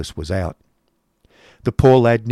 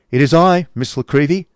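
a little higher and louder, answering; young man Nicholas; 6 semitones higher, 6 dB louder than usual. A narrator's reading. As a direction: step in and answer politely.

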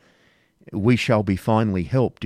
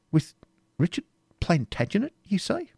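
incredulous; 11 semitones higher, 3 dB quieter than usual. A narrator's reading. Incredulous echoing question.

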